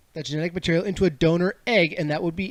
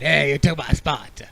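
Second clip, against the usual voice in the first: bad French accent. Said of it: "I'm gonna do a bad French accent".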